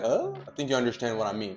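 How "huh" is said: "Huh?" shooting up high and unsure; a high rising "Huh?"; doubtful upward squeak; rising question. Shown as a demonstration. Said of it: "Not like".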